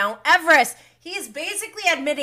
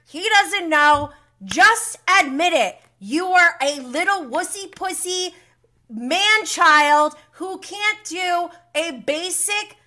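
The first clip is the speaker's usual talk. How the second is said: loud, higher, scornful tirade; furious rant; 6 semitones higher, 6 dB louder than usual. Real, not acted. Host berating Sandoval for cowardice, loud and scornful. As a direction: tear into him with disgust.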